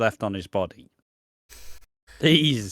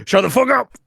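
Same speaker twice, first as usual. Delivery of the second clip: much higher, louder hammed-up yell; mock angry shout; 12 semitones higher, 7 dB louder than usual. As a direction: bark it like a furious brother. Impersonating Grant losing his temper.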